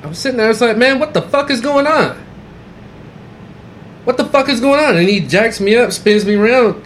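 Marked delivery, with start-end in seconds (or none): 0.8-5.0 high, urgent retold yell; imitating his own panicked protest, pitched way up; alarmed protest. Confused panic when handcuffed.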